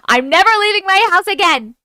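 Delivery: loud, high-pitched outburst; exclaimed vow. Real, not acted. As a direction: swear it loudly, pitch jumping up. Reacting to lost haunted doll.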